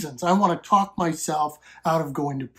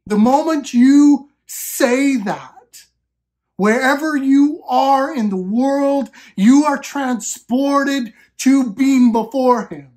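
fervent preaching; 4 semitones higher than usual. Acted. Preacher urging the congregation about God as Father, fervent and raised. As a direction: preach it out, building conviction.

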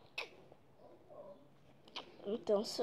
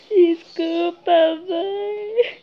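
crying apology; 7 semitones higher, 11 dB louder, much slower than usual. Acted. Child apologizing in skit.

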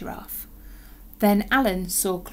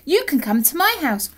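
higher, helpful dolly voice; calling little dolly; 7 semitones higher, 6 dB louder than usual. A narrator's reading. Dolly offering Alan her house, helpful call. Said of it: "called a little dolly".

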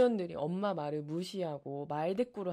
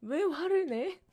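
higher, louder mock child protest; mock childish talk-back, raised voice; kid asking why; 7 semitones higher, 5 dB louder than usual. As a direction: imitate a defiant kid whining why. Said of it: "그래서".

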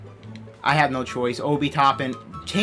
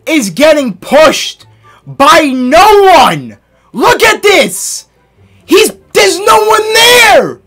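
raging gamer; 7 semitones higher, 8 dB louder, slower than usual. Real, not acted. Gamer yelling at teammates.

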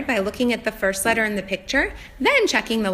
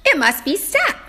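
eureka discovery; 8 semitones higher, much slower than usual. Shown as a demonstration. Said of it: "The last letter is P".

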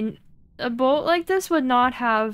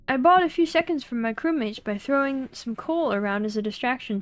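ordinary delivery

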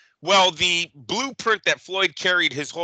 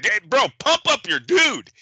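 normal talking voice

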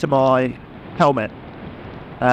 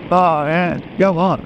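ordinary talk, nothing marked

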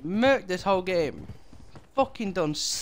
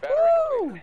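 high victory whoop; excited whoop. Real, not acted. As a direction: let out a happy cheer. Celebrating a kill.

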